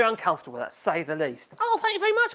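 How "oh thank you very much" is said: high, bright gush of thanks; excited thank you; delighted surprise. Real, not acted. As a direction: caught off guard by a gift. Reacting to a super chat.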